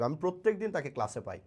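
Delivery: ordinary talk, nothing marked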